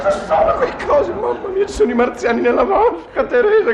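high, frantic alarm; panicked. Acted. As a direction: cry out in terror at the tub. Husband panics at bathtub.

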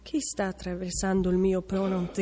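ordinary delivery

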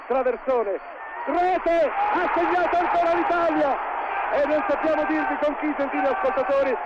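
rising to a shouted goal cry; breathless italian goal commentary. Acted. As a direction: scream the goal to the listeners. Goal call builds and peaks.

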